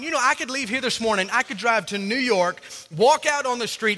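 ordinary talk

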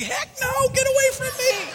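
very high, mock-terrified yelp; panicked refusal. Teen refusing the old lady at the altar, mock panic. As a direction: recoil in comic panic, pitch shooting up.

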